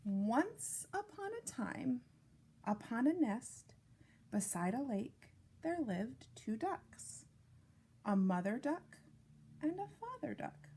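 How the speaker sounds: gentle storybook read-aloud; reading to children. Acted. Reads picture book to children.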